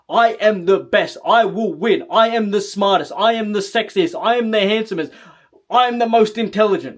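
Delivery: firm, forceful self-affirmations; powerful conviction. Shown as a demonstration. Coach modelling self-hypnosis affirmations, spoken with conviction. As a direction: declare each one with power and total belief. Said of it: "speak with power behind your words".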